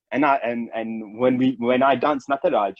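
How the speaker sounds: normal talking voice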